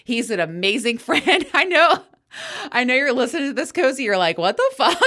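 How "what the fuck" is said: shocked. Retold or imitated. Imitating Cozy's reaction.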